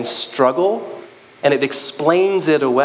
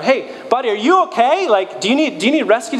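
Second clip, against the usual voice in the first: yelling down; 9 semitones higher, faster than usual. Retold or imitated. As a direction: shout down from a helicopter. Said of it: "they yell down".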